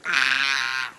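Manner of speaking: fangirl shriek. Real, not acted. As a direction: shriek over a dream shirt. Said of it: "total fangirling right now".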